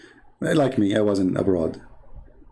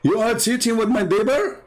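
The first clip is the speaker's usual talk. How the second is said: outraged husband; 8 semitones higher than usual. Retold or imitated. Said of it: "he find his wife cheating".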